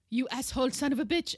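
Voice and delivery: imitating mom's sudden loud insult; mother's outburst. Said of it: "she turns to me and she's like".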